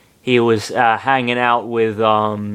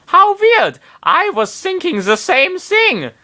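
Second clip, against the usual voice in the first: faux German accent. Popo agreeing eagerly, in a mock German accent. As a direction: shout it in a hammy German accent. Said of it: "faux Teutonic accent".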